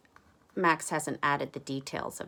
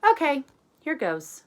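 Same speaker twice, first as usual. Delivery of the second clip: eager start; 9 semitones higher, 7 dB louder than usual. Acted. Character starts painting.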